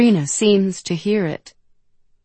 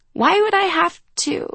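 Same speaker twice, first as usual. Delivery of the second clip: high "Why would I have to" fading out unfinished; trailing off; 13 semitones higher, faster than usual. A narrator's reading. Freya grasps what Sabrina is asking, trailing off on "to". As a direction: let "to" hang as realization dawns. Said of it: "Freya trails off as she understands".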